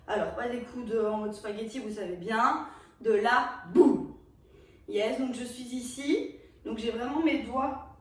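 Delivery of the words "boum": loud beat hit. Shown as a demonstration. Teacher voicing a hit.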